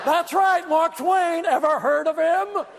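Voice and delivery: high, drawn-out boastful holler; imitating a show-off yelling for attention; mock yelling. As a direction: announce yourself to the whole lobby. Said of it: "started yelling".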